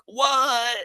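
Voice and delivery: high, incredulous what; imitating a stunned crowd; mock astonished what. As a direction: play the whole court gasping. Guys react to the news.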